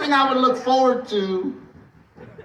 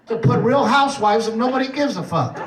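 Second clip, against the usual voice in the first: usual speaking voice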